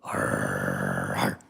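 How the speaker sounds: deep playful growl to excite the dog; mock dog growl; low growl noise. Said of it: "when you start going".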